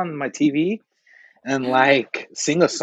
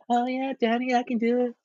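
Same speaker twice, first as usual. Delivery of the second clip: mock flirty voice; 10 semitones higher, 3 dB quieter than usual.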